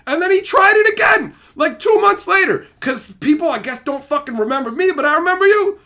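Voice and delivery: high, loud incredulous storytelling; outraged shouting. Real, not acted. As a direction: shout it in exasperated disbelief. Outraged that he tried it again.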